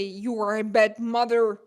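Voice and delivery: loud imitation of a hater's accusation; mock accusation. Streamer quotes a hater attacking her mothering, loud mock accusation. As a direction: hit it hard, like an accusing commenter. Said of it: "and they say".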